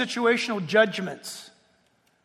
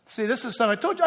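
ordinary talk, nothing marked

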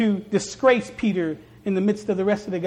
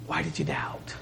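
whispered, tender question; 10 dB quieter than usual. Retold or imitated. Jesus whispers to Peter after picking him up. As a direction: breathe it softly to someone just rescued.

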